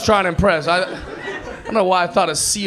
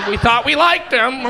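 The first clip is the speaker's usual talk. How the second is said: lightly voiced dismayed audience, higher and drawn out; slow, higher mock letdown; disappointed crowd; 8 semitones higher, 4 dB louder than usual. Comic voicing the uneasy crowd's reaction, mock dismay. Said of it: "I can feel all of you guys go".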